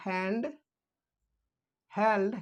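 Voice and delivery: normal talking voice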